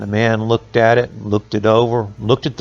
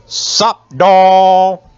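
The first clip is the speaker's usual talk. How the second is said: higher, louder eerie cat call; 6 semitones higher, 5 dB louder than usual. A narrator's reading. The witch cat taunting Jack, higher louder creature voice. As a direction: stretch out the doll like a creepy cat.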